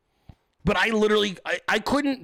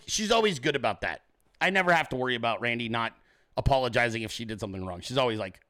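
ordinary delivery

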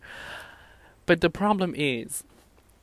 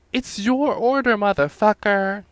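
mock furious retort; 7 semitones higher, 7 dB louder than usual. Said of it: "I was like".

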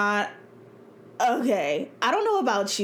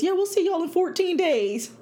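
mock boss voice; 8 semitones higher than usual. Mocking office announcement.

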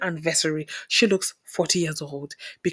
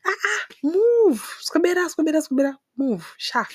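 high, mock shooing away; playful high dismissive chant; 10 semitones higher than usual. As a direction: brush them off with a high shoo.

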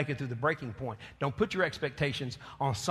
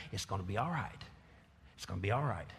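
comforting; 7 semitones lower, 8 dB quieter than usual. Pretend. God promising it will be all right, low and soft. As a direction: settle them down gently. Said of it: "But God says".

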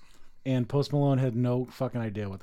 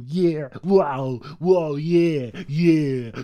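spaced-out imitation; 5 dB louder, much slower than usual. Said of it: "[name]'s like".

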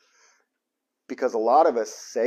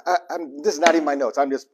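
usual speaking voice

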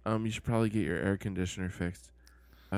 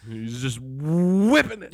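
higher, louder, slower mock bravado; mock chef swagger; 6 semitones higher, 7 dB louder than usual. Cohost echoing the chef, mock swagger. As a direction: drag it out like a showy chef.

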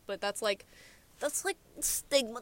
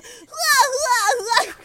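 very high, loud mock bawling; exaggerated pretend crying; fake crying; 14 semitones higher, 11 dB louder than usual. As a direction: wail in hammed-up fake sobs. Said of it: "that was fake".

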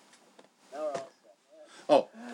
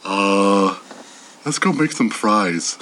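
Patrick Star; 13 semitones lower, slower than usual. Retold or imitated. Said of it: "Patrick of SpongeBob".

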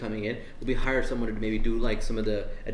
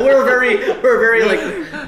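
laughing; 10 semitones higher, 11 dB louder, much slower than usual. Real, not acted. Laughing at hiring question.

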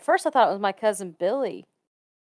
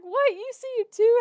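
very high, incredulous squeal; amused, high-pitched disbelief; retold astonishment. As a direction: re-enact being stunned someone else saw it. Retold shock at grandma's revelation.

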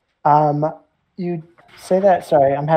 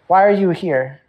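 higher, sharper challenge; indignant priest; 4 semitones higher, 4 dB louder than usual. Acted. Priest demanding why the intruders came, indignant. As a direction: demand it of them.

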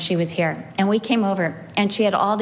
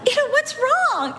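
innocent puzzled; much slower than usual. Retold or imitated. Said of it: "She's like".

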